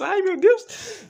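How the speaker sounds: sudden high squeal, drawn out; startled cry. Real, not acted. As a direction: yelp in real surprise.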